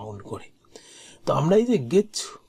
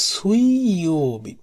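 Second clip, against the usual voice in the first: very slow, long-held vowels; slow model pronunciation; 3 semitones higher, 4 dB louder, much slower than usual. Shown as a demonstration. Teacher models Wednesday in Japanese, very slow and drawn out. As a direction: draw the weekday name right out.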